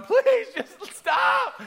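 slow, high, loud begging; pleading yell. Retold or imitated. Boy writhing in pain on the beach, slow high pleading yell. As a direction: drag out the plea in pain. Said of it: "And I'm going".

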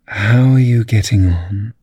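slow, lightly voiced grinning cat; sly cat voice. A narrator's reading. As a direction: purr it out slowly like a cat.

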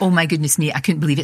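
normal talking voice

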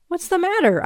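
high-pitched anxious wail; high, plaintive cry. Acted. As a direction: wail the question in alarm. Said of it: "I wailed".

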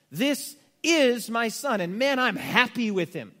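slow, firm "This is my Son"; emphatic declaration. Shown as a demonstration. Preacher voicing God's approval of his Son, emphatic and slow. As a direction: declare it with certainty. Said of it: "an emphatic, declarative statement".

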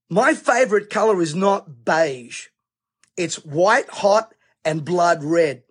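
slow, solemn preacher's declaration; slow emphatic declaration. Acted. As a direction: lay it down slowly and heavily. Preacher's slowed emphatic line.

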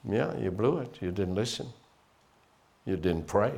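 low, soft taunting devil; hint of a sneering accuser; whispering devil's accusation. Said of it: "the devil will say to you".

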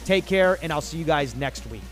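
ordinary delivery

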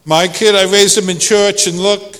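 high-pitched, exasperated protest from a doubting parent; skeptical parent. Acted. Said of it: "Some of you are sitting and going".

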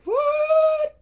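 very high shouted squeal; shocked what. Retold or imitated. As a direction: yelp in disbelief. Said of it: "You're like".

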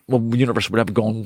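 usual speaking voice

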